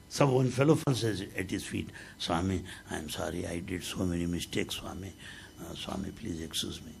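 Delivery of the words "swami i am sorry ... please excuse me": soft, low, humble begging; meek pleading devotee. Retold or imitated. Devotee at Baba's feet, softly pleading. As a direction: beg forgiveness quietly, head bowed.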